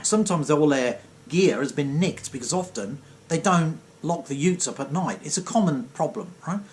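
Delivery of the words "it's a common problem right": ordinary talk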